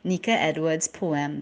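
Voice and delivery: plain reading voice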